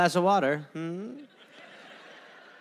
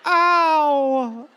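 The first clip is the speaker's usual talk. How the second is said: mock survivor; 5 semitones higher, 4 dB louder, much slower than usual. Mock survivor emerging, pained.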